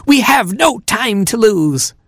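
high, pleading little bird; urgent robin hero. Acted. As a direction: press the point slowly.

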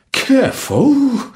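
high, slow, winded warning; drawn-out, breath-knocked-out "Careful"; puffing cook. A narrator's reading. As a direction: puff it out after a bump. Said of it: "puffed the cook".

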